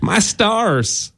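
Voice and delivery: soft, high southern exclamation; southern accent. Acted. Host showing how the phrase needs southern sound: southern accent. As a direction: gasp it like a southern belle in a southern accent. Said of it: "you would only say that with a southern accent".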